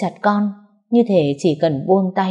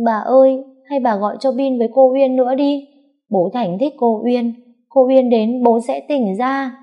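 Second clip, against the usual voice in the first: high, raised pleading little voice; choked-up child; 6 semitones higher, 3 dB louder than usual. A narrator's reading. Small boy asking grandmother to call someone, choked up. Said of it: "giọng nghẹn đi".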